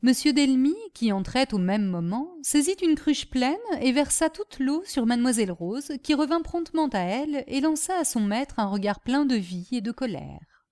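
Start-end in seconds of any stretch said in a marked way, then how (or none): none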